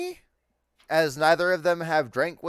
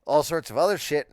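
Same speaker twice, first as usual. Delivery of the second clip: ordinary talk, nothing marked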